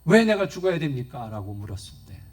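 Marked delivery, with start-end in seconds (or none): 0.0-1.3 loud, indignant challenge; defiant retort. Acted. 목사에게 대드는 권사님, 격한 항의. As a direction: fire the question back in defiance. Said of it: "싸울 기세로 대들고".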